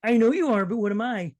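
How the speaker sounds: high, loud cartoonish catchphrase voice; Pee Wee Herman. Host giving friend a trivia clue, in a goofy character impression. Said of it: "Does that give you a clue?".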